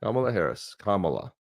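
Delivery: Kamala as KAH-muh-luh, said softly; soft KAH-muh-luh, first syllable of Kamala stressed; correct pronunciation. Shown as a demonstration. Host showing co-host the name, Kamala as KAH-muh-luh. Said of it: "If you don't pronounce her name right".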